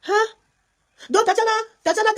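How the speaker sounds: high, loud astonished exclamation; taken aback and pitched right up. Real, not acted. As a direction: call out the name in real disbelief. Astonished by news of Tatiana's origins.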